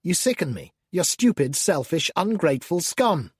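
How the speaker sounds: ordinary delivery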